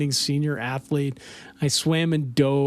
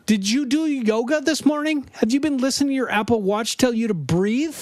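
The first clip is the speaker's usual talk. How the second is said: higher-pitched, hammed-up confrontation; mock vegan gang threat; 7 semitones higher, faster than usual. Comic retelling of vegans running someone off, mock-indignant. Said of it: "and they'll be like".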